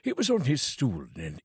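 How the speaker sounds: ordinary delivery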